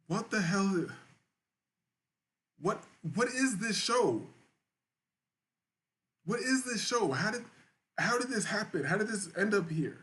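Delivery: bewildered disbelief. Real, not acted. Bewildered at his own show.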